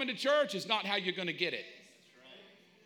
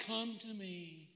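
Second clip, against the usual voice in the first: very soft, slow, airy coaxing; butterfly-gentle invitation; 11 dB quieter, slower than usual. Acted. Preacher mocking a timid reading, soft and slow. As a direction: coo it as a delicate plea. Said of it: "we've got to take this little butterfly".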